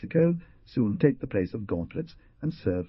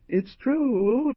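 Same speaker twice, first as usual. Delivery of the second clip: put-on young woman voice; 6 dB louder than usual. Young woman answers the Jaguar.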